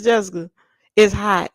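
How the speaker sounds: usual speaking voice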